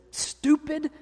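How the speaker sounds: stupid shot up high in disgust; stress on stupid. Retold or imitated. God scorns the idol, stupid pitched high. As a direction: spit out stupid on a high peak. Peak of God's outrage.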